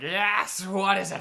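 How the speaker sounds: put-on high, raspy old hag voice; lightly creepy hag greeting; sea hag voice. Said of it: "the same voice as the hag".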